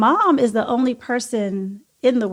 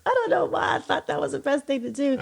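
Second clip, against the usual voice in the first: laughing; 2 semitones higher, 5 dB quieter than usual. Real, not acted. Laughing at her own choice.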